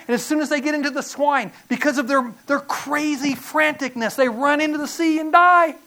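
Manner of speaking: frantic retelling. Retold or imitated. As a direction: carry the panic into the story. Preacher retells the swine's frantic rush.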